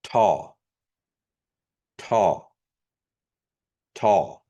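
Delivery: taw with a breathy aspirated t; regular T. Shown as a demonstration. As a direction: say taw with a puff of air. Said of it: "a regular T for".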